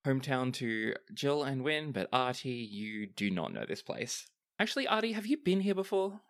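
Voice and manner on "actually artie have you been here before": much higher, curious captain voice; high character question. Acted. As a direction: ask Artie brightly up high. GM voices Kara asking Artie.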